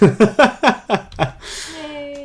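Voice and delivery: loud, high burst of laughter; real cackling laugh after a performance; big laugh. Real, not acted. Laughs after finishing the story.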